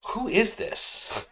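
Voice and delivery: high, slow, mock-spooked question; bewildered question. Driver to the voice from above, bewildered.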